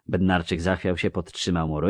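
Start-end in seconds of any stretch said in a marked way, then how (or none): none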